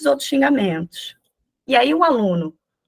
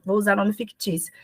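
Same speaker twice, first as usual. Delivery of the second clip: ordinary talk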